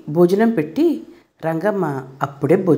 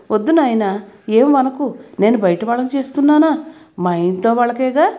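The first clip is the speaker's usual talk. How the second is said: usual speaking voice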